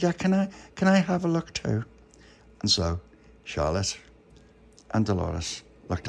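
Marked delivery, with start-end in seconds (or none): 0.0-1.9 put-on high little-girl voice; high, eager request; eager child. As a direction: pipe up high, wanting a turn. Dolores wants a look too.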